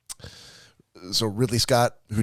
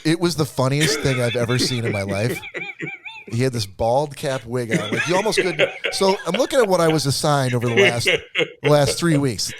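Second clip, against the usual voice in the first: words breaking into laughter, pitched up; laughing; 5 semitones higher than usual. Real, not acted. Telling friends about the movie while laughing. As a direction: laugh through the story, can't hold it in.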